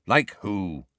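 plain reading voice

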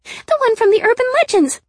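very high, loud thrilled cry; excited exclamation. A narrator's reading. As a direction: gush at full volume. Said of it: "she said excitedly".

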